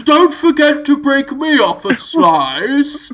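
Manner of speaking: slow, high put-on witch voice; drawn-out comic villain plea; Hugo Weaving. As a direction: call it out like a silly witch. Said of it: "and Hugo Weaving says".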